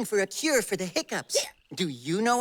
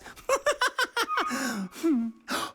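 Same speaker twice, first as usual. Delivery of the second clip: drawn-out chuckling giggle; giggle, then let it die away; giggling; 3 semitones higher than usual. Acted. Amused by continuing hiccups.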